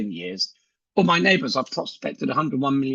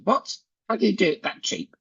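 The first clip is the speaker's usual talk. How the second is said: surprised; 6 semitones higher than usual. Retold or imitated. Young lady surprised by offer.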